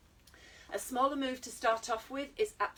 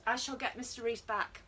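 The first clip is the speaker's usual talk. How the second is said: ordinary talk, nothing marked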